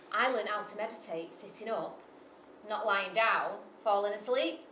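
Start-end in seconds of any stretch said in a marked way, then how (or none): none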